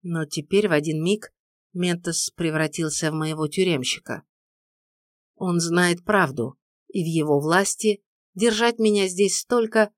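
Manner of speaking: plain reading voice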